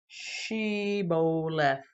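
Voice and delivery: "Shibboleth" very slowly as shee-bo-leth; correct way, drawn out. Shown as a demonstration. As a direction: stretch "Shibboleth" out syllable by syllable. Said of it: "this is the c-, correct way".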